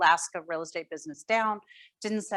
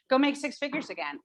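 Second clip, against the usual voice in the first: higher, sweetly friendly take on the order; nice way; 8 semitones higher than usual. Shown as a demonstration. Wife softening her husband's blunt order, higher and nice. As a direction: soften a blunt command into a kind nudge. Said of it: "And then I say that in a, in a nice, nice way".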